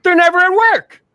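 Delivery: loud, high-pitched indignant outburst; ranting a-hole. Acted. Mocking his past a-hole self griping about teachers, ranting. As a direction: shout it out like an outraged blowhard. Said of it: "I was that a-hole that said".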